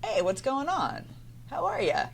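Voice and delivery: playful, lifted hello; friendly curiosity. Acted. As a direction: call out like greeting an old pal. Acting out curious friendly greeting.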